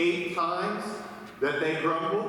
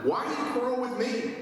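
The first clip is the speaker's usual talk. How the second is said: higher and fast, fed-up question; exasperated Moses; 9 semitones higher, faster than usual. Acted. Moses confronting the quarreling people, higher and fast exasperation. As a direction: throw it back at the crowd, exasperated. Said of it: "Moses said to them".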